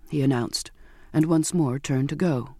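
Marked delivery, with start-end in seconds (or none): none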